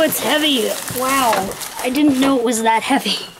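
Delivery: ordinary talk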